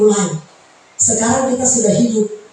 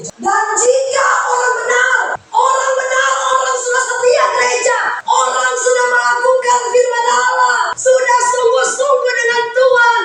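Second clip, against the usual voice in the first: preaching at full force; 11 semitones higher than usual. Acted. Pastor proclaims the righteous are barely saved, high singing cry. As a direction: proclaim the verse to the rafters.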